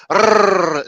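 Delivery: loud, high rolled rrr in a mock Scottish accent. Mocking how people fake a Scottish accent, with a loud rolled r. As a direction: roll the r hard like a stage Scotsman. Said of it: "they just go".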